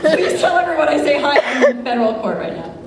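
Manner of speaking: laughing through the words; laughing while telling him where she is. Retold or imitated. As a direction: giggle out the news.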